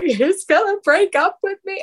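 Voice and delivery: high, wailing sob-voice; crying out the fear to her friend; retold sobbing. Sobbing to her friend about the breakup, voice pitched high. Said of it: "And I just start sobbing".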